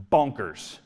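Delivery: ordinary delivery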